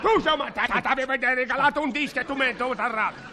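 high, fast indignant rant; shrill comic row; angry. Acted. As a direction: rant at her. Quarrel continues.

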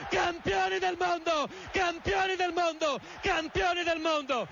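fast radio sports commentary; live radio commentary. Real, not acted. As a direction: call the match live. Live play-by-play.

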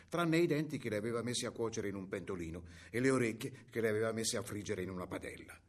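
usual speaking voice

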